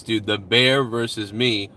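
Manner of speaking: ordinary delivery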